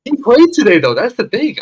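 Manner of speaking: high, loud disbelieving squeal; incredulous squeal. Real, not acted. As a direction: shriek it in disbelief. Exclaims in disbelief at news.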